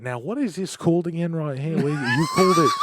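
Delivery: light imitation of a puzzled, high-voiced Hugh Jackman; mock Hugh Jackman. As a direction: play him curious and bewildered, pitch jumping up at the end. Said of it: "he would be like".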